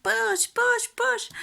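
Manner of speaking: loud, high-pitched imitated chant; mock urgent cheering. Everyone urging the mother to push, loud high mock shouting. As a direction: urge her on like a frantic birth team. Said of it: "everyone was like".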